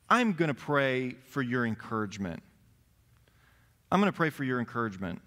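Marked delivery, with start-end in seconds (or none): 3.9-5.2 fast, rattled-off take; quick repeat. Acted. Preacher repeating the prayer line to the congregation, fast. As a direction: rush through it like a routine line.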